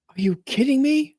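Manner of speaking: can't believe the ghost is real; shocked disbelief. Retold or imitated. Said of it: "She goes".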